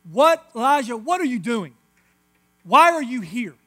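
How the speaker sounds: God calling out to Elijah in the cave, big and loud; booming voice of God. Acted. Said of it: "and he says".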